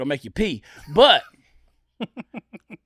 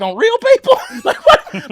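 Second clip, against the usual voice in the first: much higher and louder, squeaky disbelief; incredulous disbelief; 9 dB louder, slower than usual. Retold or imitated. Comic hearing she's a heart surgeon, shrieking disbelief. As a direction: yelp it like you can't believe it.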